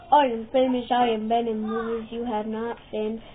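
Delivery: lightly voiced low, soft boaster; boastful wrestler promo. Wrestler taunting a rival, lightly voiced low boasting. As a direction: play a self-important star, kept low.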